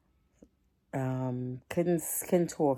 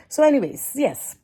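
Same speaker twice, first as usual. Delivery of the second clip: sudden burst; 10 semitones higher, 9 dB louder than usual. Real, not acted. Sudden loud restart.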